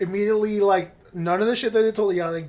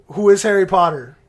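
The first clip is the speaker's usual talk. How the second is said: ordinary talk, nothing marked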